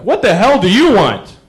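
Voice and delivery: annoyed drive-thru worker snapping at a customer through the window; copping an attitude. Retold or imitated. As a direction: give him real attitude. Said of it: "He's copping an attitude".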